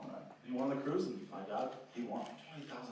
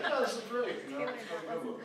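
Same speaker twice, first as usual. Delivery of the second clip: high, louder mock enthusiasm; eager agreement; 11 semitones higher, 6 dB louder than usual. Friends agree on a Goodwill trip, high and excited. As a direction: chime in like the whole gang is thrilled. Friends all keen on the outing.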